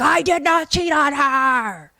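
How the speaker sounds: drawn-out, high, loud mock yell; hammed-up wounded denial, stretched and shouted; indignant yell. Carrie Larry denying cheating on his wife, indignant mock yell. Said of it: "Carrie Larry's like".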